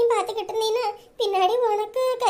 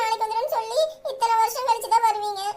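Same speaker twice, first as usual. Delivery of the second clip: ordinary talk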